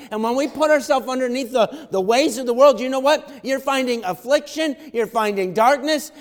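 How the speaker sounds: loud, high-pitched pulpit preaching; rising revival preacher; preaching at full force. Acted. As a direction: preach it out to the whole room. Long loud preaching stretch.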